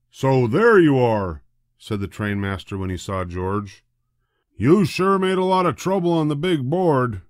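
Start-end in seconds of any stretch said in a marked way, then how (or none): 0.1-1.4 stern trainmaster. A narrator's reading. Trainmaster finds the monkey. 4.6-7.2 high, loud scolding; scolding trainmaster. A narrator's reading.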